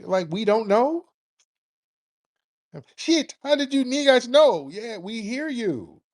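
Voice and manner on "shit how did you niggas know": higher, louder mock outburst; mocking the caller. Host mocks a caller exposed on air, higher and louder. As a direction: blurt it out as the caught caller, pitched up.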